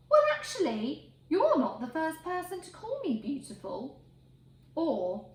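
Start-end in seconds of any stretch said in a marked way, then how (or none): 0.1-4.0 high, coy and smug; a vain pig preening at a compliment. Acted. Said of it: "things a pig might say".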